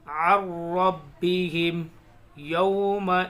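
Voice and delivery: slow, loud, drawn-out tajweed recitation; measured recitation of verses; Quran recitation. Shown as a demonstration. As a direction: chant each word slowly with long pauses. Recitation resumes.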